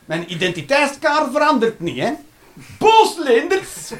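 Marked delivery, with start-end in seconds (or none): 2.8-3.9 high, slow grand naming; mock announcement. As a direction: announce it like a title. Naming himself by emotion. (hoog en traag aangekondigd)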